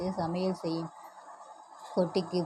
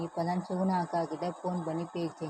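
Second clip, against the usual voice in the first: plain reading voice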